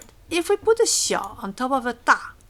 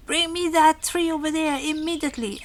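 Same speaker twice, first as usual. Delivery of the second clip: higher-pitched mock order; acted demand, pitched up and held high; urgent command; 7 semitones higher, 3 dB louder than usual. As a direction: play an insistent, demanding order. Acted order from imagined boss.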